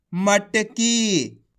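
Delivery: मटकी very slow, final ee held long; drawn-out reading. Shown as a demonstration. Teacher joins the letters into a word, drawn-out मटकी. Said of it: "आपको खींच के पढ़ना है".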